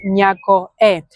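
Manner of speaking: slow, careful „някое“; slow demo. Shown as a demonstration. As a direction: draw out „някое“ slowly. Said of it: "we say".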